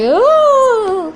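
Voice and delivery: a squealed charge cry; high mock battle cry. Charging toy soldier lets out a shrill cry.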